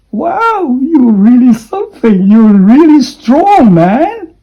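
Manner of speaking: high, loud "Wow, you're really strong, man"; hammed-up admiration for a tough friend; impressed whoop at a friend in the cold; mock amazed praise.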